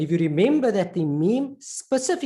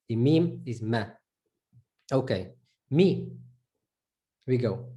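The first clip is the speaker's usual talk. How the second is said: ordinary talk, nothing marked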